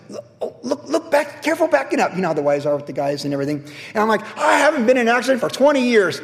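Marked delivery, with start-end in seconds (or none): 0.1-2.1 much higher, fussing warning; nagging wife. Retold or imitated. Wife Jennifer warning him while backing up, high mock nagging. 4.4-6.2 smug boast. Retold or imitated. Boasting to his wife.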